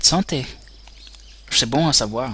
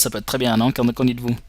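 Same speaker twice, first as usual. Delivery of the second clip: ordinary talk, nothing marked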